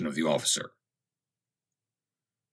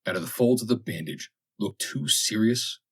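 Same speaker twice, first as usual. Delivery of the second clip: plain reading voice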